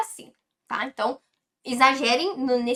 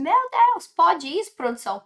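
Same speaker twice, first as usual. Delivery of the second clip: a high, loud, hammed-up gasp of disbelief; a learner's shocked protest, overplayed; mock disbelief; 6 semitones higher, 5 dB louder than usual. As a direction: play a student who can't believe it. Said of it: "Você fala assim".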